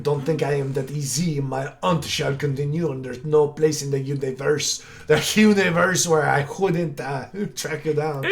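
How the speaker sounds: hammed-up Quebecois French villain; thick mock Quebec French hunter; Quebecois French accent. Hunter vowing revenge at request, mock Quebecois French accent. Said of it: "dirtiest, grossest Quebecois French".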